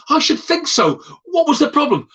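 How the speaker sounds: high, affronted reply; indignant. Retold or imitated. Jeannie indignant at the caller.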